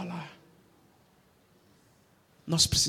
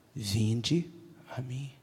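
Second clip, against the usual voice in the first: gentle invitation; 4 semitones lower, 5 dB quieter, much slower than usual. Acted. Quoting God's invitation.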